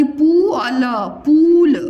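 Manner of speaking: पू held long, then ल, joined as a drawn-out पूल. Shown as a demonstration. Teacher shows the long matra, stretched-u पूल. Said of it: "प में बड़ा ओ की मात्रा लगा दी".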